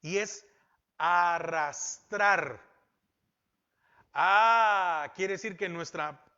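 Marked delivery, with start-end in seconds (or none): 1.0-2.6 arrastrar dragged out slowly and loud; slow, heavy emphasis. Acted. Preacher defining the word for the congregation, arrastrar drawn out slowly and loud. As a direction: drag out arrastrar slowly and loudly, as if pulling it.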